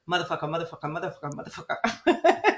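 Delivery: mock MRI machine chanting; machine noise voice. Imitating the MRI machine's chant. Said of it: "I heard".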